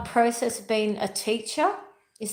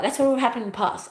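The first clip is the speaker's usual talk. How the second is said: ordinary talk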